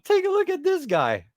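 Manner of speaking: high-pitched amused disbelief; high, incredulous squeal. Real, not acted. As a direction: squeal it at the ridiculous sight. Mocking disbelief at a figure.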